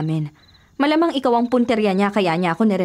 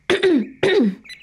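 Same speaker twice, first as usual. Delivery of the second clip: a quick, higher throat clear; 8 semitones higher than usual. Acted. Readying her voice before greeting her lover, throat clear.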